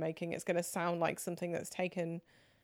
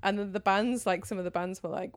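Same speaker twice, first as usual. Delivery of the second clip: ordinary delivery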